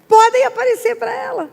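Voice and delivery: preacher voicing God telling the angels to appear; divine command. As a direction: release them with authority. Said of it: "E disse para os anjos".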